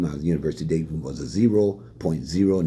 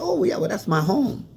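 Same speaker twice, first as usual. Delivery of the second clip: pitched way up, mock surprise; surprised high voice; 13 semitones higher than usual. Retelling his reply to the coach in the car, high and surprised. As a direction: squeak it out, half amused.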